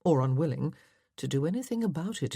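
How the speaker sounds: ordinary delivery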